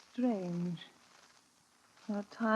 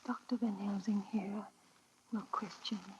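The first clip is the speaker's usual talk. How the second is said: soft, distant whisper in a trance; 2 semitones lower, 9 dB quieter, faster than usual. Acted. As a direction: murmur it as if half asleep. Mina speaks in a trance.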